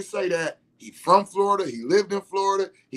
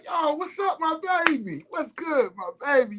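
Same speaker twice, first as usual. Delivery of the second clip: much higher, lively call to a friend; hyped homeboy greeting; 11 semitones higher than usual. Shown as a demonstration. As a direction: greet your boys up high and excited. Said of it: "I do greet them like".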